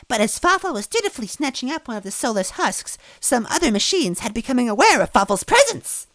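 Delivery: very high, showy servant voice; Farfel, theatrical servant. A narrator's reading. Farfel telling the worms, very high theatrical voice. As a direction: ham it up proudly.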